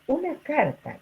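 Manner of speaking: "una carta" said with a sharp high pitch jump on "una"; focus stress on una carta. Shown as a demonstration. Teacher showing students the fronted object, pitch leaping up on "una carta". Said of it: "fíjense ahí".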